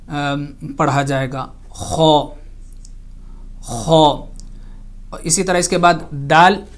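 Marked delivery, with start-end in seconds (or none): 1.7-4.2 خو said thick and heavy from the upper throat; heavy kho. Shown as a demonstration. Teacher showing students how to say خو heavily. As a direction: give خو a full, heavy throaty sound. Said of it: "اس کو موٹا کر کے اس کو".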